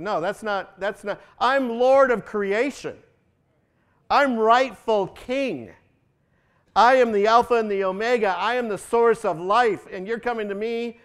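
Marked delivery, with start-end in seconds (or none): 1.4-11.0 loud, high, declaiming; proclaiming God. Acted. A preacher thunders God's majesty at the congregation, loud and high. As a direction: proclaim it like a herald from the pulpit.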